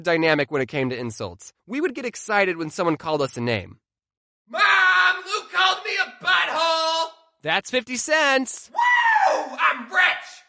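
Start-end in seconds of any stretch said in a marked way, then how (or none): 4.5-7.1 high, shouted tattle to mom; excited kid tattling. Retold or imitated. Kid reporting an insult to mom, high excited shout. 8.7-10.4 very high shouted whoop; gleeful kid cheer. Retold or imitated. Kid cashing in fifty cents, high gleeful shout.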